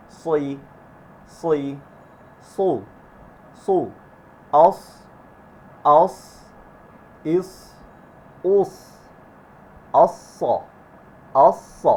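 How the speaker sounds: emphatic S in "অস্স" with tongue slightly curved; thick, full S through "সই, সো, অস"; heavy s. Shown as a demonstration. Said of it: "একদম হালকা curve". (জিহ্বা হালকা curve করে মোটা "স")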